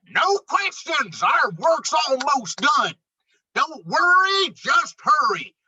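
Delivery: a high, loud brush-off of questions; impatient moose. Acted. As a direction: hustle them along, no time for doubts. Moose hurries the friends.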